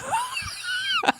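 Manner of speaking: real laugh, squealing up high; big laugh. Real, not acted. Host bursts out laughing at his own lasagna punchline.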